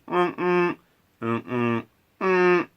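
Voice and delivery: high humming honks; imitated car horn beeps. To listener, humming the car horns of Hong Kong. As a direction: hum like beeping car horns.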